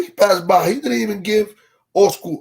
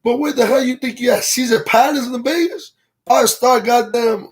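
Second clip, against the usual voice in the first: amused mock outrage; 6 semitones higher than usual. Real, not acted. Mocking sleeping kid, laughing.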